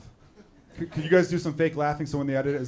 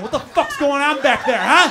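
loud, pitched-up mock heckle from the back; mock yell; 7 semitones higher, 9 dB louder, slower than usual. Comic shouting the crew's imagined complaint, loud mock yell. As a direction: yell it like an annoyed crew guy.

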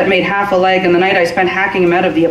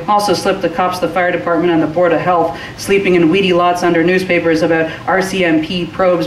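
plain reading voice

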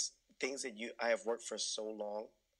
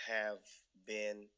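ordinary delivery

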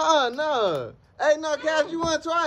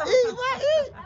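cheering someone on; 10 semitones higher, much slower than usual. Real, not acted. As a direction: cheer him on, voice climbing high. Cheering friend in eating race.

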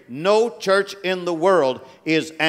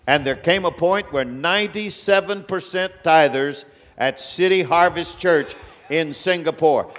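ordinary talk

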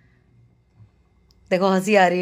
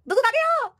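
excited squeal. Real, not acted. Excited call.

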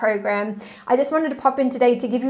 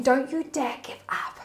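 soft whisper; angels whispering; 6 dB quieter than usual. Acted. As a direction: breathe it like a secret urging. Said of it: "your angels are saying".

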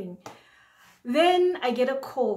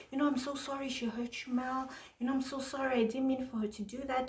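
soothing apology; 2 semitones higher, 8 dB quieter, faster than usual. Retold or imitated. John comforting her on phone.

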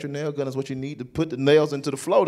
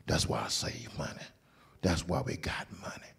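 whispered boast, almost unvoiced; hushed brag; 13 semitones lower, 12 dB quieter than usual. Dad whispers his secret to the son, hushed.

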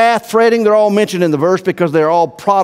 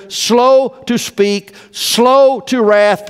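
slow and raised, each word drawn out; 8 semitones higher, much slower than usual. Shown as a demonstration. Pastor reads James to church, very slow and high. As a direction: stretch every word as you say it. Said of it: "let every man be swift to hear".

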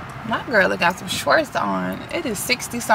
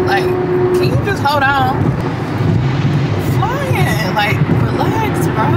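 higher, drawn-out exasperated plea to other drivers; 10 semitones higher, 4 dB louder, slower than usual. Real, not acted. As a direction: stretch it out, fed up with the traffic. Scolding drivers who rush her.

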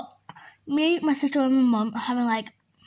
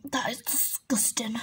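much softer, unvoiced disgusted verdict; disgusted whisper; 7 dB quieter than usual. Real, not acted. To viewers after tasting Fanta, a disgusted whisper. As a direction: breathe it out in revulsion.

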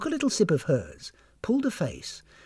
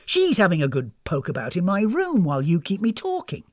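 higher, indignant voice; accusing guest; 4 semitones higher, 4 dB louder than usual. Acted. Guest accusing hostess's daughter of snooping, indignant. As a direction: spell out the accusation crossly.

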